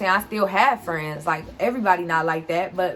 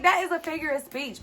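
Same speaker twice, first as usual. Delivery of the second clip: indignant protest; 8 semitones higher, 5 dB quieter, faster than usual. Retold or imitated. Teen explaining to the guard, high exasperated protest. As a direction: still higher and softer, exasperated protest.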